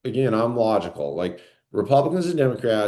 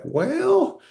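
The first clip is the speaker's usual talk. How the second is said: Trump impression; 11 semitones higher than usual. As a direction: imitate a politician's serious warning, pitched way up. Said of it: "that's how he says it".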